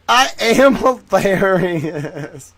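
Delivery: loud, slow words breaking into laughter; helpless laughing through the words; real laughter. Real, not acted. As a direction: crack yourself up mid-sentence. Laughing at own joke.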